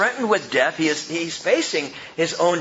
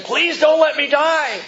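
higher, louder, slow begging plea; frightened plea; 9 semitones higher, 5 dB louder, slower than usual. Retold or imitated. Preacher mimics a dying man begging in fear. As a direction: beg like a man terrified of dying.

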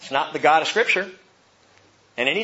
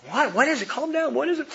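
high, urgent retold questions; mock-alarmed dad trying to settle someone; alarmed questioning; 11 semitones higher than usual. Dad to his upset daughter at the stairs, alarmed.

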